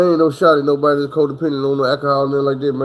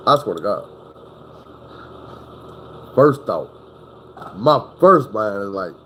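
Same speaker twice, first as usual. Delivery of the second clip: normal talking voice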